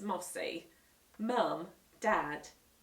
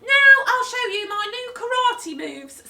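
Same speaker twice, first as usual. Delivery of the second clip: put-on higher, louder eager boy; boastful Henry; 15 dB louder than usual. Henry announcing karate, loud eager boy. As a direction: show off.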